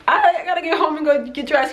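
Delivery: high, laughing mock excuse. Turning down the boat captain, high and laughing. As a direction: giggle it out, dodging the invite. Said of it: "And I'm like".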